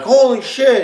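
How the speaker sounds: high, loud startled outburst; family shocked. Retold or imitated. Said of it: "They're like".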